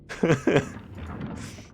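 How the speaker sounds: high, airy chuckle tailing off; real amused giggle; chuckling. Real, not acted. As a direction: chuckle and let it die away. Tickled by own joke.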